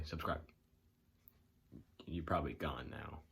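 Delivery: ordinary talk, nothing marked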